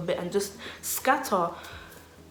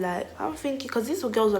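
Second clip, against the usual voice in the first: ordinary delivery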